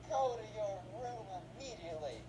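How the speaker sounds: a lightly voiced mom sending her son to his room; stern mom. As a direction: bark the order like a fed-up mom. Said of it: "Ben's mom's like".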